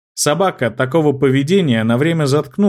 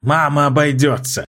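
hissing. Acted. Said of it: "шикнул вертлявый".

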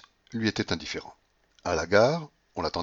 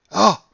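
high, loud "ah" of dismay; shocked cry; 12 semitones higher, 13 dB louder than usual. A narrator's reading. Grandmother seeing her abandoned number win, shocked cry. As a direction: cry out at the loss. Grandmother exclaims at missed zero.

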